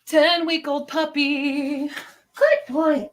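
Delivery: high, drawn-out pet voice; puppy cooing. Real, not acted. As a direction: coo slowly over a puppy.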